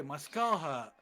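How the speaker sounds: normal talking voice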